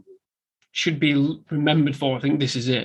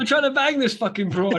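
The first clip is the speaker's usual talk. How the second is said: higher, brash mob guy line; mock mobster boast; 8 semitones higher than usual. Co-host voicing Tommy in the car, higher. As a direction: brag it like a wiseguy. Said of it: "trying to get him to date".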